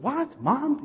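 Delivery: very high, stunned question; shocked disbelief. Retold or imitated. Husband hearing mother-in-law died, shocked disbelief.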